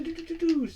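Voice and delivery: very high little doo-doo-doo shots; imitated missile noises. Shown as a demonstration. Player imagining a spaceship shooting, high imitated firing sounds. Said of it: "imagine going".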